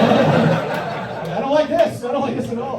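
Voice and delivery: high, drawn-out complaint; mock distress. Comedian reacting to his own joke about girlfriend, high mock distress. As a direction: whine it high in mock dismay.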